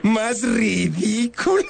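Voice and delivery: ordinary talk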